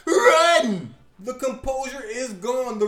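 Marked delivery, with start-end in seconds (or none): 0.1-0.9 high, very loud alarmed bellow; drawn-out panicked scream; full shout. Acted. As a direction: yell it for your life. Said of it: "and Ringmaster shouts".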